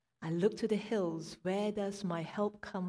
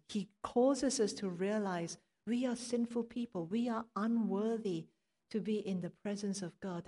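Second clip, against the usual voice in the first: ordinary delivery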